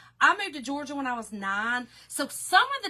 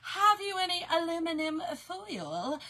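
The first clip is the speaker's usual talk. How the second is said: higher, over-enunciated fancy request; mock-proper speaker; 4 semitones higher than usual. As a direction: parody someone with time to speak properly. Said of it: "No, I ain't got time for that".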